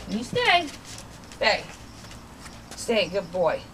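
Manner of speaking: low, firm stay command. Real, not acted. Trainer telling her dog to stay, low and firm. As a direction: hold the dog in place.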